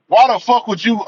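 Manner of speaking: usual speaking voice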